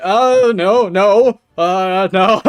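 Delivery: high, loud cries of protest; hammed-up dad in a panic; frantic dad. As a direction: struggle through a crowd, protesting loudly. Voicing dad wading through chaos.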